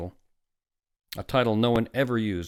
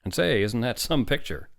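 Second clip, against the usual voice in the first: louder, higher hearty enthusiasm; 5 semitones higher, 6 dB louder than usual. A narrator's reading. Doctor admiring the picture, hearty. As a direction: admire it in a big voice. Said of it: "said Doctor Hitz heartily".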